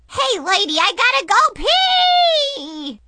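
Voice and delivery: high, loud, slow desperate whine; whiny little girl. Acted. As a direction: beg like she can't hold it. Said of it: "Brianna interrupted".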